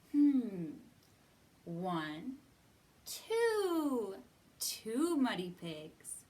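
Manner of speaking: ordinary delivery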